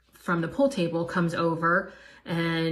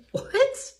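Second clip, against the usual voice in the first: much higher, rising in shock; shocked disbelief. Retold or imitated. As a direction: shoot it up high in disbelief. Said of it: "I was absolutely stunned".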